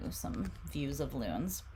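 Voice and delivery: ordinary talk, nothing marked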